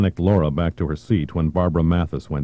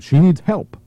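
raised, pleading voice; urgent plea; 12 semitones higher, 6 dB louder, slower than usual. Acted. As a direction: beg loudly for help. Delegate protests hostage girl's injury.

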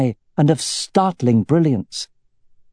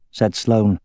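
plain reading voice

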